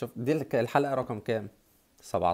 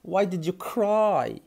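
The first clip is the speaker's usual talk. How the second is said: slow and higher; slow teaching; 7 semitones higher, much slower than usual. Shown as a demonstration. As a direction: model it slowly, voice up. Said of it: "لماذا بكيت؟".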